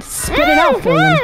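high, pleading call; urgent yelling. Real, not acted. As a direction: beg them to spit. Urging friend to spit.

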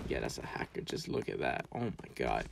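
low, very quiet hushed talk. Real, not acted. Gamer hiding from a hacker, speaking hushed. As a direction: keep your voice down while hiding.

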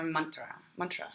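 mantra as "MUN-tra" with a slightly rolled r; "mantra", short "uh" first, r lightly rolled. Shown as a demonstration. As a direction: softly say "mantra" with a little roll on the r. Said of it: "the R has a little bit of a roll".

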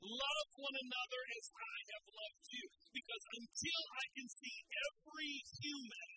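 loud, driving sermon delivery; full-force preaching. Acted. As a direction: preach it hard to the crowd. Preaching to congregation.

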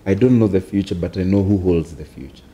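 ordinary talk, nothing marked

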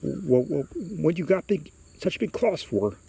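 put-on timid boy questioning a monster; mildly frightened boy. A narrator's reading. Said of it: "so frightened he can't move".

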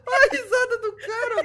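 high, loud words through laughter; laughing while talking. Real, not acted. Gamer laughing at his friend's flying car, words through laughter.

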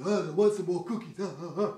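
growly, airy monster greedy for treats; Cookie Monster. Acted. Teacher showing the air in husky singing, monster voice. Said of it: "when Cookie Monster talks like this".

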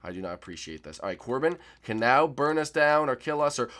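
ordinary talk, nothing marked

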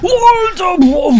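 high startled yell of fright; startled shout. Real, not acted. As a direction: jump and shout in shock. Jumpscare in horror game.

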